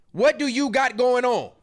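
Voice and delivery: ordinary talk, nothing marked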